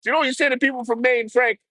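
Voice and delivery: high, mocking put-on voice; a high goofy taunt aimed at the local. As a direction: taunt him in a high silly voice.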